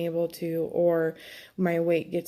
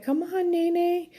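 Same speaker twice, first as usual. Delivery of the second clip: put-on high, childlike pleading voice; a little boy begging his aunt to play; nephew's voice; 12 semitones higher, slower than usual. Aunt imitating her five-year-old nephew begging her to play, high and childlike. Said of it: "Lincoln's always like".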